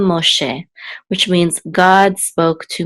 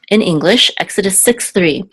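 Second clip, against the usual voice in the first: ordinary talk, nothing marked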